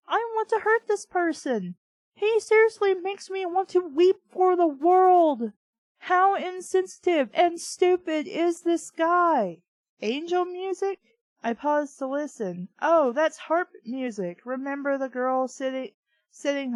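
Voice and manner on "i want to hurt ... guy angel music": high, loud exasperated venting; pitched-up furious disbelief; fuming rant. Real, not acted. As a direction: rage at how insensitive he is. Fuming inner rant about Marco.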